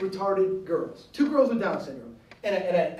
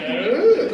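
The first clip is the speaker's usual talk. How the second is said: grunt; 3 semitones higher, 8 dB louder than usual. Retold or imitated. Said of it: "And he's like".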